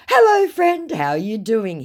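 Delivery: very high, loud put-on hello; scammer's greeting. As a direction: gush hello as the scammer, then settle. Acts scammer greeting, later repeated plain.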